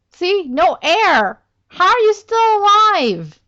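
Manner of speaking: high, loud disbelieving yell; shocked shout. Real, not acted. Shocked at character surviving.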